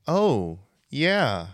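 light up as it clicks; mock eureka, high and loud; excited realization.

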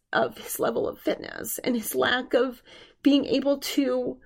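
talking through real emotion about her dad; emotional. Real, not acted. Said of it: "I'm getting emotional".

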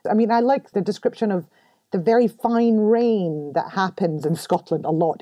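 ordinary talk

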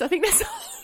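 whispered aside, high and breathless. Acted. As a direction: whisper it conspiratorially. Whispered aside, then repeated.

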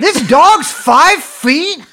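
incredulous. Real, not acted. Shocked disbelief at dog size.

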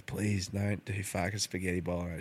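begging his wife not to cook it, quiet and pleading; soft mock plea. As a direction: beg quietly, half-joking. Pleading with his wife about dinner.

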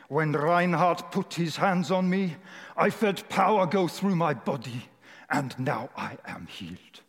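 put-on slow, deliberate German accent; German-accented testimony, settling down at the end; Reinhard Bonnke. Woman testifying to the boy's father, slow German accent. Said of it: "Doing my best German accent".

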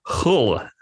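Kola with a throaty, h-like Russian K; attempted Russian accent. Shown as a demonstration. Co-host showing how Kola sounds in Russian, attempted Russian accent. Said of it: "I can't say that in a Russian accent".

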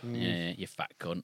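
low, slow, quiet put-down; low slow insult voice. Pretend. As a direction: growl it slowly and low. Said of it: "That was pretty good".

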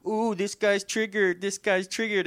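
high sing-song taunt; mocking. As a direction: parrot the words back in a high mocking voice. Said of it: "like, mocks him".

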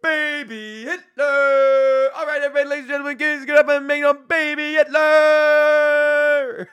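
slow, high, loud ring-announcer intro; hype announcer. Pretend. Trying out the joke intro on the crowd, loud slow announcer. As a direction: work the room like a boxing MC. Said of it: "I should try that".

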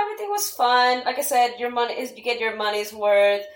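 usual speaking voice

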